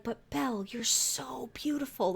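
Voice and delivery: slow breathy whispered compliment; gushing fan. Retold or imitated. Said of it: "gonna be like".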